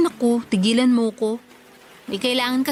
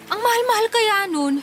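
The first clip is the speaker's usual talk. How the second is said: much higher, pleading and exasperated; it's far too expensive, can't you see; exasperated protest; 9 semitones higher than usual. Acted. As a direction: daughter pushing back at her mother. Daughter protests the laptop cost.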